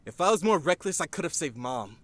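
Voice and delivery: heated protest. Acted. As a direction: argue it back hotly. Teen argues, wishes he'd been reckless.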